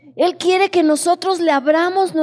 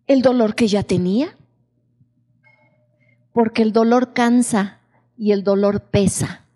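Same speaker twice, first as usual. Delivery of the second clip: ordinary talk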